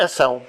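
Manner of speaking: AÇÃO with a closed, reduced first A; unopened vowel. Shown as a demonstration. Teacher showing the consequence without the C, closed first vowel. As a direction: say AÇÃO starting on a muffled uh, not an open ah. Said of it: "abre a letra que está antes, a vogal".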